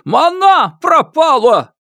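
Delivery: drawn-out, very high anguished shout; hysterical yell. A narrator's reading. Father cries that his daughter is missing, hysterical yell. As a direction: wail it loudly, stretching each word. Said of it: "истерично заорал Ма Дуньчэнь".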